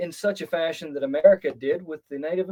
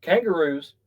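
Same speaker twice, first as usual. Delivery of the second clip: usual speaking voice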